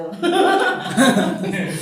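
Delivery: loud burst of self-mocking laughter. Real, not acted. Teacher amused at her own pride, loud laugh.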